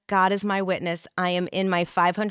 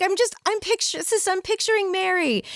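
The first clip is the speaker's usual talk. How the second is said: sister weepy; 12 semitones higher than usual. Retold or imitated. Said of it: "she's like".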